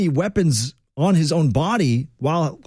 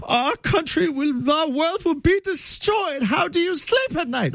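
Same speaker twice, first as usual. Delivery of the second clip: high-pitched, slow mock Scottish accent; drawn-out, squeaky-high imitation in a would-be Scottish accent; Greta Thunberg; 12 semitones higher than usual. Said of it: "why I threw that accent on".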